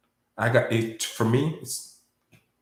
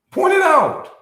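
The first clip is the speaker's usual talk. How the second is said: very high, loud demand; emotional shout; 10 dB louder than usual. Real, not acted. Speaker daring doubters to show the lie, emotional shout. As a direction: yell it as a heated dare. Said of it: "I get emotional".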